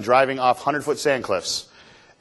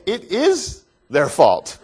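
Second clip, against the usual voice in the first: very slow, higher, with drawn-out IS; indignant protest; 5 semitones higher, much slower than usual. Retold or imitated. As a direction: insist stubbornly, stretching the "is". Protests that they are to blame.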